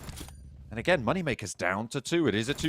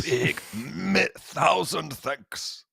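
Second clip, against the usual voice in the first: drawn-out, very slow thousand thanks; slow mock translation; 4 semitones higher, much slower than usual. Caster translates grazie mille in a very slow drawn-out voice. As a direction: stretch each word out.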